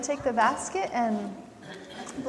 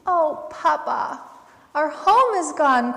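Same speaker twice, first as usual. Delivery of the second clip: mildly distressed little ones, pitched higher; storybook child's lament, higher voice; crying out; 6 semitones higher, 6 dB louder than usual. A narrator's reading. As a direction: cry out to Papa in mild dismay. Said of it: "cried the young possums".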